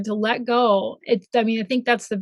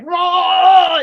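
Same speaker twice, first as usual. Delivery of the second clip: a higher, louder half-shouted whoa; mock whoa; 7 semitones higher, 6 dB louder than usual. Telling the host about her career switch, a loud mock whoa. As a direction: blurt it like the ride just dropped. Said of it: "there's just, like".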